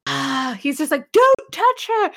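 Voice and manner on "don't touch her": high, loud warning; hammed-up indignant shout; mock protective yell. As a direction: burst out as the outraged defender. Acting out Scotty defending Uhura.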